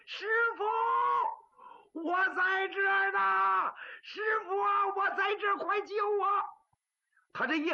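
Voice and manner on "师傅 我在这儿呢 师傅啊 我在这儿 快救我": high, loud cries for help; desperate crying shout. Acted. Chained boy on tiptoe screams to his master for rescue, high and crying. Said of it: "敲着脚他就喊开了".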